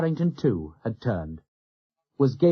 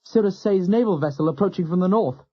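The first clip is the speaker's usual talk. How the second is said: higher, urgent relay of the signal; excited report; 6 semitones higher, 3 dB louder than usual. A narrator's reading. Lookout relaying the Cirrus signal to captain, excited. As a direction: pass the news on fast and eager. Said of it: "he reported excitedly".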